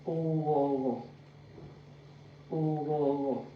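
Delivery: "Oworo" as a drawn-out "oh-waw-raw", gently falling; example-word demo. Shown as a demonstration. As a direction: model "Oworo" in three linked, falling syllables. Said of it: "We have this in".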